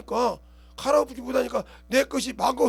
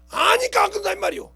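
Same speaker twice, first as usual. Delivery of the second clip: much higher and louder cry; emphatic cry; 8 semitones higher, 5 dB louder than usual. Real, not acted. Preacher crying out to the congregation, high and loud. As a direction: burst out with conviction.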